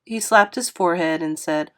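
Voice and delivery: ordinary delivery, nothing marked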